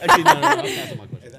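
high, fast, through laughter; laughing. Real, not acted. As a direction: blurt it out laughing. Backing out, laughing hard.

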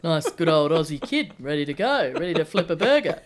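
knockabout talkback politician, building from plain grumble to loud rant; mock patriotic outrage, rising into a chanting shout; Peter Dutton. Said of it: "Your impression of Peter Dutton".

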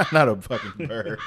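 laughing while talking. Real, not acted. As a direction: laugh through the words. Laughing at the bird joke.

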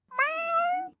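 very high, loud lion mewl; little whimper. Acted. Worried lion by the box, high little whimper. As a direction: squeal a tiny whimper like a worried cat. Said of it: "He gave a little whimper".

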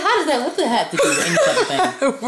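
high, exasperated retort breaking into laughter; half-laughing retold outrage; indignant. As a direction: demand an answer in high-pitched disbelief. Retells own indignant retort to coworker.

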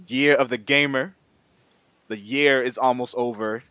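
usual speaking voice

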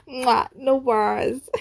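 high, loud Gen Z slang; ironic zoomer. Pretend. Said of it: "That's so cringe".

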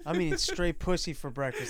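usual speaking voice

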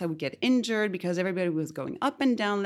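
ordinary talk

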